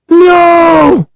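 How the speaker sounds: high, drawn-out scream of horror; terrified scream. A narrator's reading. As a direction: scream it out involuntarily. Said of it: "I screamed without realizing it".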